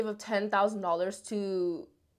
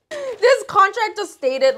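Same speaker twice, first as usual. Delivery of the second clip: amused exclamation; 11 semitones higher, 7 dB louder, slower than usual. Real, not acted. Still amused by the contract.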